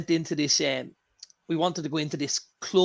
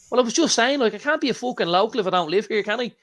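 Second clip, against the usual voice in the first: cheeky retort; 6 semitones higher than usual. Retold or imitated. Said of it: "You know, like this".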